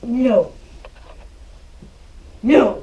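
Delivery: loud, firm no to the dog; sharp scolding no. Shown as a demonstration. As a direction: bark it like a stern correction. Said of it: "Scold him, no".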